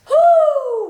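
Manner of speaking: high loud breath out to steady herself; shaky exhale. Real, not acted. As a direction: blow out hard to hold back tears. Fighting back tears, exhales.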